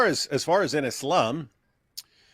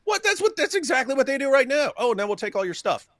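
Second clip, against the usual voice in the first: mocking outrage; 13 semitones higher than usual. Real, not acted.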